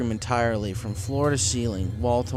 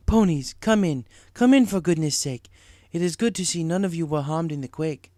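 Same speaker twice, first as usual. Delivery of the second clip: rhyming mystic zebra; Zecora voiced; 6 semitones higher, 4 dB louder than usual. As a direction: welcome them urgently inside.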